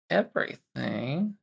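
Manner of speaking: usual speaking voice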